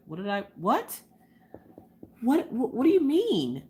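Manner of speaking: high, halting, baffled questions; mock dumbfounded reaction; retold bewilderment. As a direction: stammer in disbelief, pitch climbing. Retold confusion at winning.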